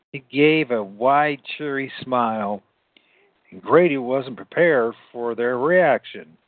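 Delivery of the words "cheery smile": plain reading voice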